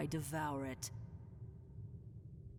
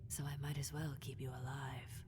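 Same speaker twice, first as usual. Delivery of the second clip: whispered threat; 5 semitones lower, 7 dB quieter than usual. Acted.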